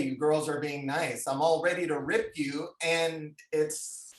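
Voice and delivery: ordinary talk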